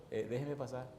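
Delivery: soft, tentative retold request; low-volume, undemanding ask; first polite request. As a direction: ask quietly to be let through. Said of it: "vuelvo y le digo al hombre".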